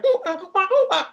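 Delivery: put-on high wailing baby cry, far above the own voice; imitated baby crying. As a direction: mimic a wailing infant, loud and high. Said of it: "always a baby crying".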